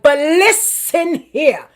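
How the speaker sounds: slow, loud, pointed comeback; slipping up in a retort. Pretend. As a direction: draw it out like you finally snap back. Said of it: "slip up and be like".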